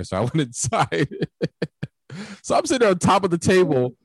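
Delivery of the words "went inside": ordinary talk, nothing marked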